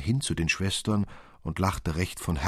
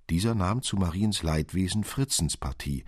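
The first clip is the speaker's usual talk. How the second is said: usual speaking voice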